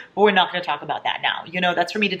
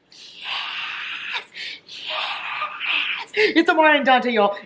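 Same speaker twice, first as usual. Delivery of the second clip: thrilled squeal; 9 semitones higher, much slower than usual. Real, not acted. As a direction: build from a whisper to a squeal of joy. Thrilled at new book reveal.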